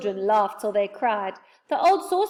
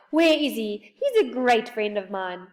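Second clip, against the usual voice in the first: loud, excited question; eager cry; 5 dB louder than usual. A narrator's reading. Saucepan man asking after his great friend, eager and loud. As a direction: burst out eagerly, then ease off. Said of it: "he cried".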